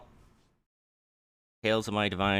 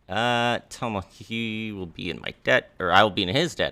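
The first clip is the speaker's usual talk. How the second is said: usual speaking voice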